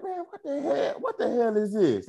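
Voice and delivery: high, startled disbelief; surprised. Retold or imitated. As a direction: squeal it out in shock. Shocked at finding stuck vitamin.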